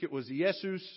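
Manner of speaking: "Jesus." said YEH-soos, Greek Iesous; "Jesus." pronounced as Greek yeh-soos. Shown as a demonstration. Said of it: "In Greek".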